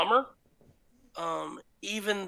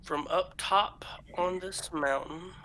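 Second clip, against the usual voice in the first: ordinary talk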